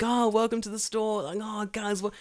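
high, overly bright shop greeting; fake cheerful clerk. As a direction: put on forced customer-service cheer.

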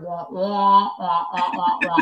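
droning muffled trombone teacher voice; muffled wah wah talk. Retold or imitated. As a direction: wah it out like Charlie Brown's teacher. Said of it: "like Charlie Brown's teacher".

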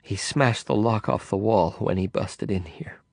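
fading into a breathless whisper; weakening whisper. Acted. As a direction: let the voice drain to a whisper. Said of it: "her voice weakening".

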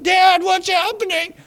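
high, loud imitation of upset children; hammed-up child's cry to Dad; mock crying kids. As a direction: wail it like kids panicking in the back seat. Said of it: "They all started crying".

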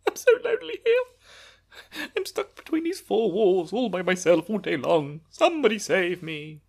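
high wailing fake sob; hammed-up lonely wail; mock crying. Mock lament about being alone.

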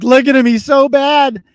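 high, loud mimicry of scandalized critics; exaggerated, jeering imitation of outrage; mock outrage. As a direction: parody a pearl-clutching accuser. Mimics critics reacting to shared video.

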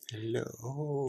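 spooky voice. Acted. Greeting in spooky voice.

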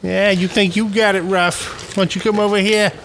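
high-pitched mock Boston challenge; irate Bostonian. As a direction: pick a fight in a fake Boston accent.

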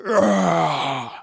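loud ugly monster groan; crying monster ugh; monster sound. Acted. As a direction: roar it out like a monster. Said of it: "No, be a monster".

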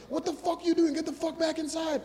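high, slowed mock yell; mock crowd yell. Crowd yells at comedian to go back inside, high mock yell. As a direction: play the angry crowd ordering him out.

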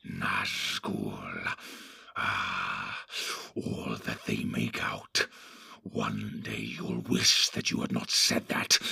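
a low menacing creature, scheming; Grishnak the sly orc. Acted. Grishnak warns.

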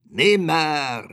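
loud, high raven's croak; harsh bird cry of doom. A narrator's reading. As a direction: croak it out like a raven, drawn out and falling. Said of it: "krächzte da der Rabe".

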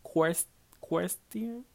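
slow, unsure "question?" with a rising tune; halting "question?"; struggling with question. Real, not acted. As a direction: sound out "Quest-, question?" hesitantly, rising at the end. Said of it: "I don't know how to fucking say this word".

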